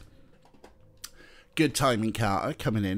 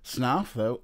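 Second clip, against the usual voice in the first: ordinary talk, nothing marked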